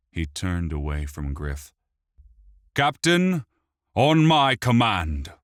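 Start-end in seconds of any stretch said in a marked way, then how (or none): 2.7-5.3 loud order to the guard; commanding shout. A narrator's reading.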